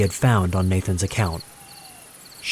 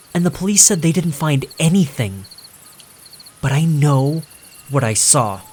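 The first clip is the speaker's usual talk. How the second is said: higher, shaky insistent woman; pleading young woman; 6 semitones higher than usual. Acted. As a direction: insist she knows what she saw. April insists to the owner.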